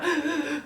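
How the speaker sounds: high, shuddering mock sobs; imitated sobbing gasp. Vlogger showing how she was already crying, imitated sobbing gasp. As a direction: act out gasping sobs. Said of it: "like that".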